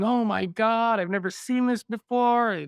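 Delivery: high-pitched, hammed-up panic; mock freaking out. Doctor looking at a patient's sickness, mock freak-out. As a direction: play a doctor losing composure. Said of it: "freaking out and crying".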